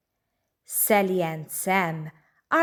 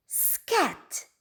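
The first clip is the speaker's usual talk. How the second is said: mild mock cry; 9 semitones higher than usual. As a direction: shoo the cat away. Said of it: "cries Missus Brown".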